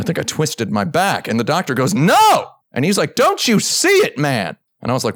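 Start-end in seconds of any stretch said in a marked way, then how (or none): 1.9-2.5 loud, high exasperated "No!"; mock outburst. Doctor explodes in frustration. 3.1-4.6 high, loud, incredulous; exasperated plea. Retold or imitated. Doctor pleading in disbelief.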